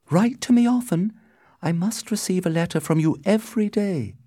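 high-pitched Juliet at parting; high, pleading farewell to her love; Juliet, pleading lover. A narrator's reading. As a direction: voice a lovelorn heroine, pitched high.

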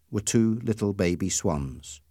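usual speaking voice

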